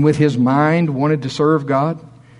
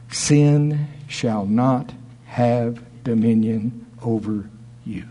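slow and deliberate; 3 semitones lower, much slower than usual. Acted. Slow scripture emphasis.

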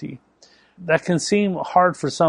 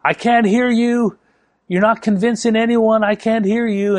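retold yelling; 8 semitones higher, 3 dB louder than usual. Husband yelling from across the house to rile his wife, mock shouting. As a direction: call it out as if across the house, goading. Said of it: "he'd, he'd yell".

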